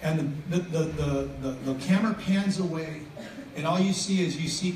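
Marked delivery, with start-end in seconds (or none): none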